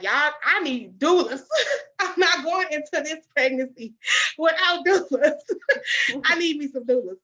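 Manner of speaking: high, laughing, half-crying insistence; laughing plea. Retold or imitated. Begging friends for doulas, laughing and loud. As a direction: laugh through it while pleading.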